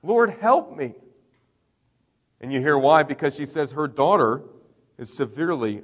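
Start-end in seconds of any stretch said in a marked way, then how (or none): 0.0-0.9 high, loud plea for mercy; pleading. Retold or imitated. Desperate mother begging Jesus for mercy, retold pleading cry. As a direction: cry out for help with a pitch leap on "help". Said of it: "pleading for mercy".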